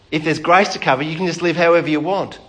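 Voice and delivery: ordinary delivery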